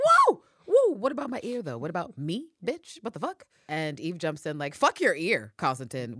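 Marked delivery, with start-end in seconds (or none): 0.0-3.4 high, loud indignant protest; indignant yelp. Retold or imitated. Constantine protests losing antibiotics. 4.7-6.2 sharp retort. Retold or imitated. Eve snapping at Constantine.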